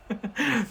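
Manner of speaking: short high giggle; real laugh. Real, not acted. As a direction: giggle at your own sound effect. Laughs at his clipper sounds.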